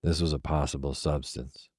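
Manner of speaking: ordinary delivery, nothing marked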